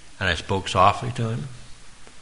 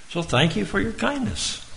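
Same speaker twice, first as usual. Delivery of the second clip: much higher, bright mock-sweet thank you; high sing-song thanks; 11 semitones higher than usual. Shown as a demonstration. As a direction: lift it up like a cheerful farewell. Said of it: "We could say".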